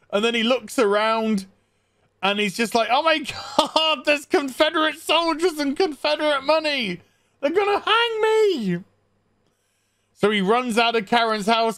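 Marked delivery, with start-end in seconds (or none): 2.9-8.8 very high, hammed-up terror; mock panic. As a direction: panic higher and higher, half laughing. Acting husband panicking in bathroom.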